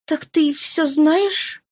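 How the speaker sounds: slow, lifted, wondering question; slow high question. Acted. Sick woman asks her lover, slow and high.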